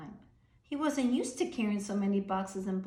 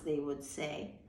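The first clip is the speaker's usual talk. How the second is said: ordinary delivery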